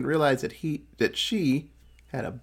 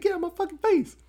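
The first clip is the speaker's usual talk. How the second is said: fast, high mock-annoyed snap at a fan; imitating shooing off a pushy fan; annoyed brush-off; faster than usual. Fan keeps popping up, speaker fast mock-annoyed. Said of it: "I'd been so mad".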